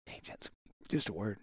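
retold in a very quiet, confidential voice; discreet aside. Takes Jess aside discreetly in retelling.

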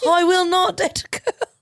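high British recitation through laughter; giggly mock British schoolroom line; British accent. Umbridge lines in British accent.

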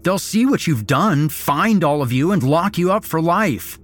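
high, loud, fast warning; desperate bluffing threat; defiant threat. A narrator's reading. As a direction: threaten them with rescue. Captive threatening captors.

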